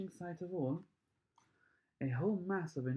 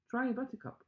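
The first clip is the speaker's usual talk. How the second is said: high, bright call to try one; eager cry; 9 semitones higher than usual. A narrator's reading. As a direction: urge them on with delight. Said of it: "cried Mister Wonka".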